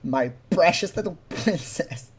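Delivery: slow, leaning on "precious" with a laugh; slow, savoring "precious", breaking into a laugh; heavy emphasis. Shown as a demonstration. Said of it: "with so much emphasis".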